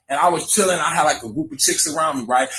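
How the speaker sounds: usual speaking voice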